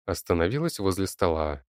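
ordinary delivery, nothing marked

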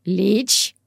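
short, hoarse rasped question. Acted. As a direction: rasp the word out. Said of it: "просипела я".

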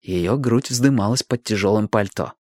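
ordinary delivery

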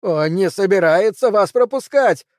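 high, loud yell over the wind; shouting over wind. A narrator's reading. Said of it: "прокричал сквозь ветер Пелвис". (кричит сквозь ветер)

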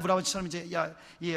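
usual speaking voice

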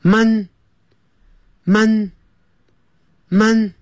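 Mon- vowel like 饿, held long; drawn-out Mon. Shown as a demonstration. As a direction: stretch Mon- with an open 饿 vowel. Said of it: "讲肚子饿的那个饿". (用“饿”的元音慢念Mon)

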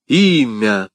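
pressing, drawn-out demand; insistent questioning. A narrator's reading. D'Artagnan presses her for the name, insistent. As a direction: press for the answer. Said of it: "допытывался Д'Артаньян".

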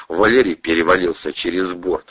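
usual speaking voice

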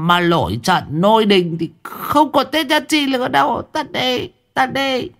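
put-on very high, pinched pleading old man; wheezy cat voice. A narrator's reading. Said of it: "lên tiếng như là mèo hen".

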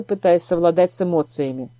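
usual speaking voice

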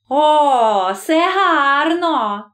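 high, drawn-out gloating exclamation; greedy delight, pitched high and stretched out; hawkish glee. A narrator's reading. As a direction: crow slowly with the delight of a hawk. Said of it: "в яким почулася радість яструба".

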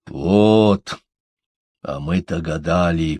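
drawn-out opening word, then settling down; drawled. A narrator's reading. Торин realises what puzzled them, drawling. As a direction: stretch it out as realisation lands. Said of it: "протянул Торин".

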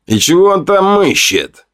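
high-pitched, sneering question; mocking smirk. A narrator's reading. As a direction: ask it with an audible smirk. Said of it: "с усмешкой спросил Рант".

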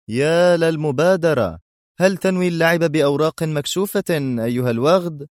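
high-pitched mocking admiration; sarcastic. A narrator's reading. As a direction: jeer at the villain's offer. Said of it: "ابتسم أدهم في تهكم وقال". (تهكم بنبرة مرتفعة)

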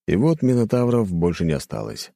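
plain reading voice

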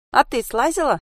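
put-on high-pitched, doubting child; distrustful question. A narrator's reading. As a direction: ask as if you don't believe her. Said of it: "недоверчиво спросила Анька". (недоверчивый детский вопрос)

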